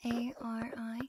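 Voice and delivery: slow, hushed letter-by-letter spelling; drawn-out, whispery spelling for relaxation; ASMR name trace. Acted. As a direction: spell it softly, letting each letter linger.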